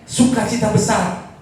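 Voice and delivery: high, loud, drawn-out proclamation; full-force preaching. Real, not acted. Preacher declaring endless love and great joy, fervently.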